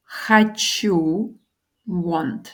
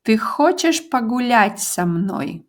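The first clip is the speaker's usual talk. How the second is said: "Ты хочешь погулять со мной?" said slowly with stress on хочешь; slow model question; 2 semitones higher than usual. Shown as a demonstration. Teacher modelling the question slowly. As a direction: say it slowly for the learner. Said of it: "do you want to go for a walk with me".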